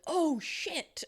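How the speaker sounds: high, alarmed "Oh, shit"; startled oh shit. Shown as a demonstration. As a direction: blurt it high, as if startled by something. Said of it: "has anyone asked them, like, did he go".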